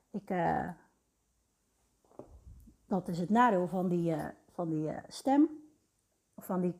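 strained, hoarse sore-throat voice. Real, not acted. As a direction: speak through a sore throat. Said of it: "keelpijn die ik heb".

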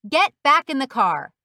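loud, forceful shouted order. A narrator's reading. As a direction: shout it as an angry officer's command.

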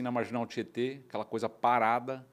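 ordinary talk

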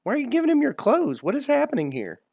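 high-pitched, incredulous questioning; baffled disbelief. Acted. One player baffled at another giving away clothes, incredulous. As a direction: squawk it, utterly baffled.

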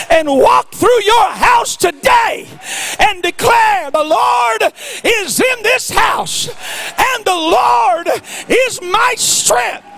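preaching at full force. Acted. Preacher urging people to declare it, full shout.

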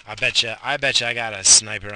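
loud paranoid joke; loud mock paranoia about a sniper. Real, not acted. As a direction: call it out loud, half joking.

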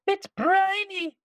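very high, slow disgusted voice; disgusted pirate. Acted. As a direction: whine it with disgust. Pirate complains.

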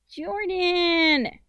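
put-on high, loud child's angry call; small, angry, high-pitched yell. A narrator's reading. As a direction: call out crossly like a little sister, high and drawn out. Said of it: "angry voice from the garage".

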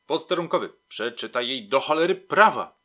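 loud, cursing police command; emphatic order. A narrator's reading. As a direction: drive the order home, fed up. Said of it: "powtórzył z naciskiem Smyczek".

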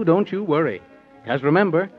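ordinary delivery

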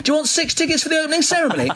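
high, fast imitation of a chirpy tout; cheeky tout. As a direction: rattle off the offer like a street seller. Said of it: "He was like".

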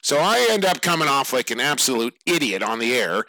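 ordinary talk

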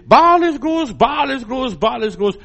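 high, loud chanted cry, fading; priests screaming. Retold or imitated. Retelling the Baal priests crying to their god, loud frenzied chant. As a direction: scream the god's name in a frenzy. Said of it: "Und da schreien sie".

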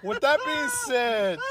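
slow, still laughing; trying to stop laughing; cracking up mid-line. Real, not acted. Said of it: "I'm laughing at my own joke".

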